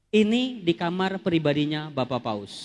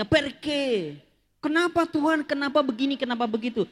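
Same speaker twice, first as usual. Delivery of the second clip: anguished plea; 7 semitones higher than usual. Retold or imitated. Retold anguished prayer.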